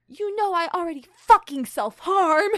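high, very loud melodramatic yell; overacted screaming confession. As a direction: blurt it at full volume. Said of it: "I have to lower my voice".

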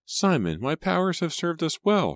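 high, insistent wife defending herself; surprised wife. A narrator's reading. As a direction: protest in high-pitched surprise. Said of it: "gazed up at him in surprise".

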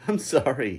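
high, loud, giddy apology; amused apology. A narrator's reading. Lucas apologizing while splashing about, amused. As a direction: cry it out playfully. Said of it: "he laughed as we splashed about".

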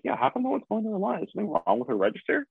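high, quick baffled questioning; puzzled disbelief. Retold or imitated. Shopper wondering about the empty register line, high-pitched puzzlement.

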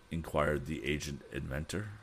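plain reading voice

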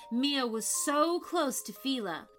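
usual speaking voice

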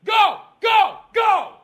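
loud, high yells at the cat; angry shouting. Real, not acted. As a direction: shout short commands to scare it off. Yelling at cat on table.

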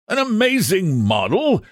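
high-pitched theatrical outcry, not full volume; exclaimed shout. A narrator's reading. Painter echoes his friend's praise of the model, high mock shout. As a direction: cry it out high, incredulous. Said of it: "shouted Trevor at the top of his voice".

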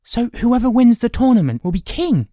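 high, loud, astonished question; eager demand. A narrator's reading. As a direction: demand an answer like an excited boy. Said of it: "he demanded".

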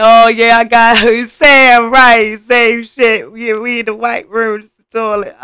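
mimicking the daughter cracking up at the joke; laughing daughter. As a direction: put on her giggly voice through the laugh. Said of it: "She laughed at".

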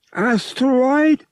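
high, slow alien question; slow, high creature voice asking. Acted. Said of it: "the Togorian suggested".

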